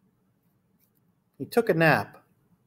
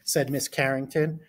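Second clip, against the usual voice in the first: put-on reading to children. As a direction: read it to a class of small kids. Reads picture book aloud.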